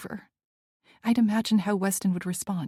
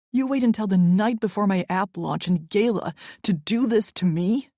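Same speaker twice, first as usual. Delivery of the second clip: angry confrontation; 4 dB louder than usual. Acted. Angry boyfriend confronting her.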